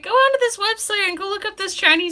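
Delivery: high-pitched mock voice giving instructions; imitating sister. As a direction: hold it way up high, quoting her. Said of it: "she's like".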